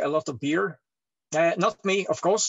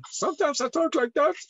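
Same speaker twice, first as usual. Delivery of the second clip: much higher, put-on voice; high changed voice; 10 semitones higher than usual. Shown as a demonstration. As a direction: jump up into a high register. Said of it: "My voice is changing at all time".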